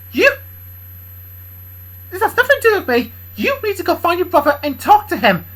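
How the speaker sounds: loud, high scolding character voice; angry Kendra. Acted. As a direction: scold him hard, voice up high.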